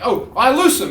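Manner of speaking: high, loud counter-command; combative comeback. Retold or imitated. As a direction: fire back, voice jumping up. Acts out countering the binder.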